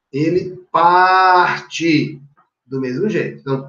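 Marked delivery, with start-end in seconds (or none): 0.1-2.2 "Ele parte" stretched very slowly; slow, emphatic teaching demo; slow stress demo. Shown as a demonstration. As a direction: draw out "parte" to mark the stress.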